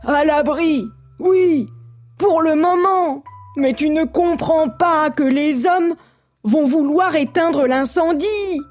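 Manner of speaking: high, slow, insistent warning; agitated little creature; alarmed frog. A narrator's reading. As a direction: argue back anxiously, high and stretched. First frog argues back in alarm.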